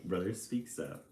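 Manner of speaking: plain reading voice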